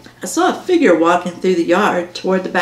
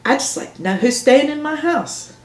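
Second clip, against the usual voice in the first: mildly indignant, pitched higher; a mother defending herself, voice lifted; 6 semitones higher than usual. A narrator's reading. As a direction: say it with a touch of huffy indignation. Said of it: "all indignant".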